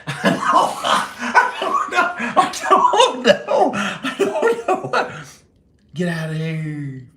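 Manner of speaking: high, slow words lost in laughter; breathless high laughing. Real, not acted. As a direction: giggle helplessly through it. Laughing fit.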